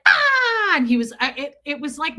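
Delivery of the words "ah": loud, high imitation shriek; imitated kid's scream; mock scream. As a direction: burst out in a high mock screech.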